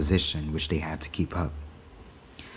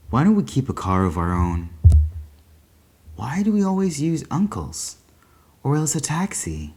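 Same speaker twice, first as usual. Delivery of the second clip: a hint of a curious young boy, higher; lightly voiced boy; 6 semitones higher than usual. A narrator's reading. Paul asking his mother about the car, lightly boyish. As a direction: ask innocently like a small boy.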